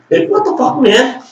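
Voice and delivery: can't believe the double standard; exasperated what the fuck. Real, not acted. Outraged at hypocrite.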